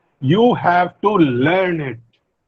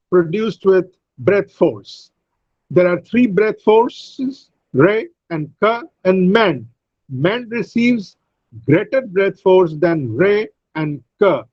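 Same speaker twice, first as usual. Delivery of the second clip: normal talking voice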